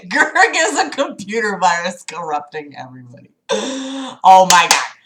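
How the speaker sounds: normal talking voice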